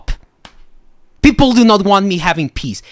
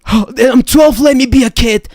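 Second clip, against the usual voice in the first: higher whiny mock child; mock kid; 8 semitones higher, 4 dB louder than usual. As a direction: whine it like a bratty twelve-year-old. Mocking a kid's excuse.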